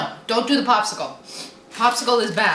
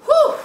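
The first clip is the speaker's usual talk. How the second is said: high loud exhale through burning mouth; sharp whew; 7 dB louder than usual. Real, not acted. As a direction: blow out the heat with a sharp whew. Exhaling from pepper burn.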